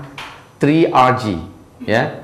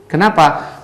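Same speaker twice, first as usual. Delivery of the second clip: usual speaking voice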